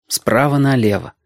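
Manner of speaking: ordinary delivery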